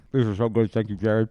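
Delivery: talking while chewing; chewing noisily. Real, not acted. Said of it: "you're chewing and eating right now".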